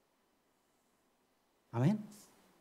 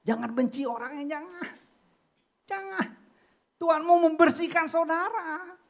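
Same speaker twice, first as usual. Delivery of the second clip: raised, louder preaching voice, urging; preaching at full force; 12 semitones higher, 6 dB louder than usual. Acted. Preacher urging the church not to hate, full-force preaching. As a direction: press the plea on the congregation.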